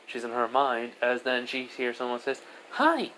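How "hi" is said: put-on very high, chirpy child hello; high-pitched kid surprise greeting; little girl's greeting. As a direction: pipe up like a small child. Child suddenly greets lonely girl.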